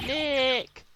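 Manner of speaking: high, loud drawn-out yell; shouting. Acted. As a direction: bellow the name up high. Shouting to trigger noise-activated camera.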